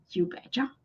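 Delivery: low, quiet, questioning repeat; hushed puzzlement with a rising end; puzzled echo. Retold or imitated. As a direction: echo it softly, unsure what it means. Echoes unfamiliar phrase, puzzled.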